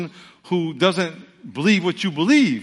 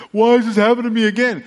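whiny complainer; 7 semitones higher, 8 dB louder, faster than usual. Acted. Preacher voices a complaining believer.

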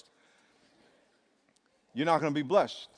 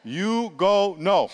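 drawn out, very slow assurance; emphatic drawn-out; 2 semitones higher, 4 dB louder, slower than usual. Retold or imitated. Pastor assuring the donor, drawn-out emphasis. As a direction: stretch "You go know" slow and sure. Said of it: "preacher quoting himself".

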